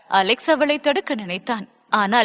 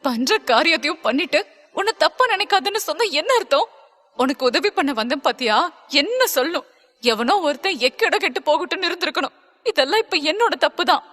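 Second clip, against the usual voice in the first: high, upset female voice; bitter anger; 4 semitones higher than usual. Acted. Stella regretting she ever helped Alex, high and bitter. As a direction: throw his words back, pitched high. Acted quarrel, bitter self-blame.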